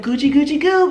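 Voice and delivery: playful coochy-coo voice; tickling baby talk. Retold or imitated. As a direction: mimic someone tickling a baby. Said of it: "when they be like".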